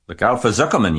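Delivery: high, loud warning yell; gander yelling. A narrator's reading. Gander warns fleeing Wilbur, yelling. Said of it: "yelled the gander".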